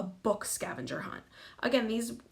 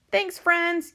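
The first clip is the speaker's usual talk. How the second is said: high, loud call of thanks; grateful yell; 10 semitones higher, 7 dB louder than usual. A narrator's reading. Captain thanking the sea monster, high yell. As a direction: call out thanks across the deck. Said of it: "yells Captain Pete".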